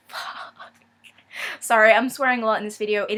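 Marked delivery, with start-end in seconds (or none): 0.1-0.7 breathy whispered swear. Real, not acted. Muttered swear while unwrapping.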